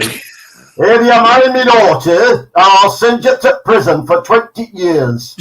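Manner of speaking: high, drawn-out angry father yell; shouted threat. Acted. Father threatening the boyfriend with a shotgun, loud slow shout. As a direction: bellow it slowly like a threatening dad.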